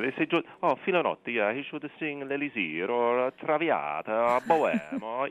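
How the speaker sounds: high, loud imitation of an Italian accent. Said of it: "he has a really thick Italian accent".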